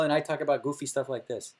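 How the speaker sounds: ordinary delivery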